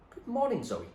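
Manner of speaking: put-on old man greets a girl in a dark porch. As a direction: greet her from the dark, oddly bright. William greets Zoe.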